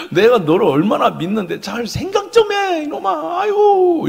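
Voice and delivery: pleading reproach climbing very high; exasperated father. Acted. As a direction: wail at a son you trust, rising to a high sigh. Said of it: "이런 거예요". (답답해하며 타이르는 아빠)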